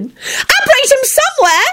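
very high, stamping demand; shouted tantrum. A narrator's reading. Ginny orders Lupin to act, shouting. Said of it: "Ginny shouted and stomped her foot".

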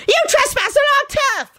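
fast, very high screech; shrill scream. A narrator's reading. Woman accuses the trespassers, shrill screaming. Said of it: "screamed shrilly".

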